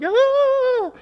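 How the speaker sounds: sudden high shriek of panic; a startled group scream; scream of fright. Retold or imitated. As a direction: shriek like teens scared in the dark. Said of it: "And we're like".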